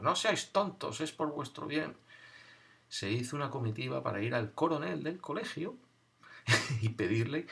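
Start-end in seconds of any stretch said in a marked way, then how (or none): 0.0-2.0 high, chiding nun's voice; scolding nun. Retold or imitated. Nun telling the boys off, high and dismissive. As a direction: brush off the silly boys like a stern nun.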